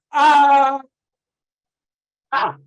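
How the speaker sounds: full-throated releasing yell, high and loud; big yell. Real, not acted. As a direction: let it all out in one huge yell. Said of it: "we're all just gonna let out a big yell".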